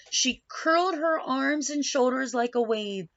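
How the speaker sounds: plain reading voice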